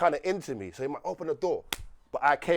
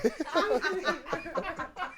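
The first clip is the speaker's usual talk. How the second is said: a burst of high-pitched laughter; 12 semitones higher, 5 dB quieter than usual. Real, not acted. Storyteller cracking up at his own door-latching, high laughter. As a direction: let a high cackle escape.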